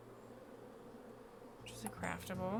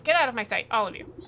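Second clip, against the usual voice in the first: much louder royal dismissal; furious shout; 3 semitones higher, 12 dB louder than usual. Acted. Queen bee throws everyone out, shouting. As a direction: bellow them out of the room.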